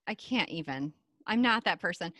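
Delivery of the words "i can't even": softer, falling resigned repeat; quiet. Shown as a demonstration. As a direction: drop the voice on the repeat.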